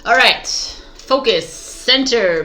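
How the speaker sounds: loud self pep talk; firm self-command to focus; psyching herself up. Real, not acted. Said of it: "Be present, [name]".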